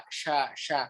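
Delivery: sha, sha with air spread across the mouth; Arabic shin sound. Shown as a demonstration. Teacher demonstrating the Arabic shin to students, airy sha. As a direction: hiss the sha wide across the tongue. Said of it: "Maksudnya adalah menyebarnya di mulut".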